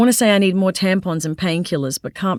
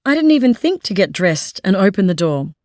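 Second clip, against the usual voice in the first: ordinary delivery, nothing marked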